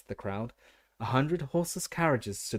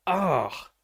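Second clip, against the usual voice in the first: startled cry; 6 semitones higher, 5 dB louder than usual. A narrator's reading. Water balloon bursts on head.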